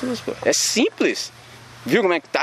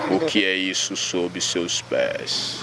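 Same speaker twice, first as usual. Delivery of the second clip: the voice of God, deep and drawn out; Cid Moreira voice; 8 semitones lower, much slower than usual. Retold or imitated. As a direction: intone it slowly like a grave TV news anchor. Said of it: "com a voz do Cid Moreira".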